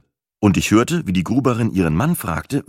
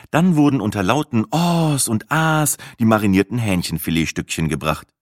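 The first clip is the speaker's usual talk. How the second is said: ordinary delivery, nothing marked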